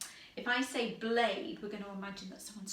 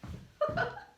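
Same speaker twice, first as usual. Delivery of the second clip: 1 breathy high laugh. Real, not acted. Laughs during game.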